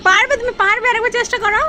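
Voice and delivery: urging her pet to grab the puffed rice, high and coaxing; coaxing pet talk. Acted. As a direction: cheer the little one on like a baby. Cheering pet on.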